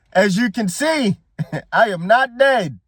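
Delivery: hammed-up regal line broken by laughter; T'Challa impression. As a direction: play a proud African king, half laughing. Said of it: "What my man T'Challa say?".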